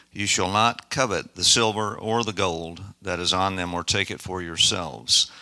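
usual speaking voice

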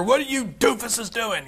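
a hint of a mother scolding; 1 mock scolding mom. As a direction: play the exasperated mom lightly. Friend's mother scolding the boys.

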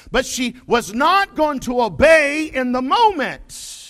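loud, high, emphatic pulpit delivery; forceful preaching. Real, not acted. Preacher drives the lesson home to his congregation, forceful preaching. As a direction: build to a loud, hard-hit final point.

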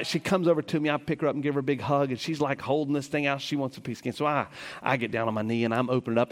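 ordinary delivery

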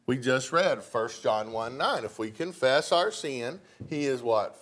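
ordinary delivery

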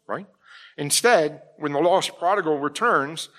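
ordinary delivery

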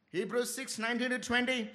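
ordinary talk, nothing marked

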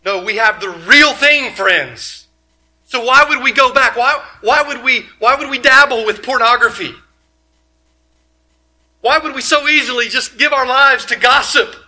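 loud, high, driving sermon questions; preaching at full force. Acted. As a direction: hammer each why at the congregation.

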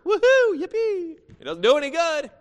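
a hammed-up sarcastic woohoo; sarcastic cheer. Real, not acted. Preacher mocking empty church routine, sarcastic cheer.

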